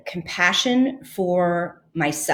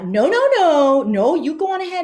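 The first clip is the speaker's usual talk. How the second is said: sarcastic refusal; 10 semitones higher, 4 dB louder than usual. Retold or imitated. Angry man refusing sarcastically.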